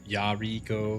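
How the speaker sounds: "Yariko" as YAH-REE-koh; "Yariko" with full, open first vowel and long middle; shifting name pronunciation. Shown as a demonstration. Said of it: "change the way I pronounce your, your name".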